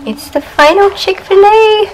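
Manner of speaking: high, loud and slow proclamation; dramatic announcement. Acted. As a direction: announce it like a grand farewell. Dramatic farewell to Chick-fil-A.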